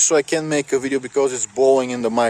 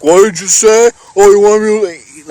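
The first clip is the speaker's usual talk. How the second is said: higher, much louder mock challenge; drunk picking a fight; 7 semitones higher, 10 dB louder than usual. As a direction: bark the challenge at him. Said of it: "He's like".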